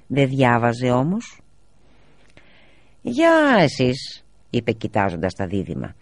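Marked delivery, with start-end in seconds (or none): 3.0-4.3 high, slowly stretched hello; father's long, high-pitched greeting; drawn-out greeting. A narrator's reading. Father greets children ominously.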